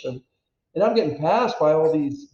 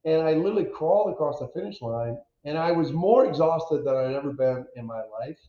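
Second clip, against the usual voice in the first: usual speaking voice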